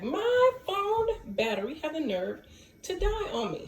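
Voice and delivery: indignant about the dead phone; high sassy complaint; exasperated. Real, not acted. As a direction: pitch jumps way up in mock outrage.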